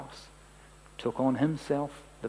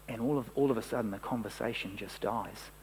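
usual speaking voice

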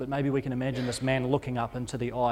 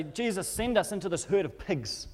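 higher, urgent plea; demons pleading; 6 semitones higher than usual. Acted. Demons begging Jesus to send them into the pigs, higher urgent plea. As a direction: beg Jesus for the pigs instead. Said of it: "they look over here and say".

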